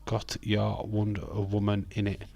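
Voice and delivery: ordinary talk, nothing marked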